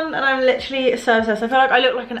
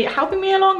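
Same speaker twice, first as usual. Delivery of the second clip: teasing; 10 semitones higher than usual. Real, not acted. Teasing viewers.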